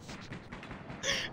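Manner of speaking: soft airy laugh; breathy laugh. Real, not acted. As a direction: wheeze with laughter. Laughing after fall.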